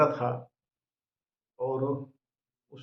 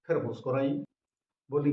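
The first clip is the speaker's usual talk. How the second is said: ordinary delivery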